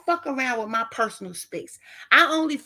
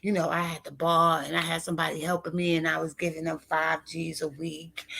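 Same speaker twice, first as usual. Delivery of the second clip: mocking the guy; 8 semitones lower, 5 dB quieter than usual. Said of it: "Then he telling me".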